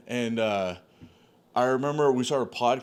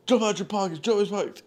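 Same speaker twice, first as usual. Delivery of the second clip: reenacted rant; 7 semitones higher, faster than usual. Reenacts wigging out on everybody.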